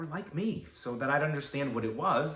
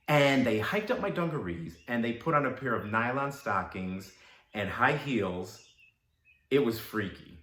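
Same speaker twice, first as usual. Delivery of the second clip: ordinary talk, nothing marked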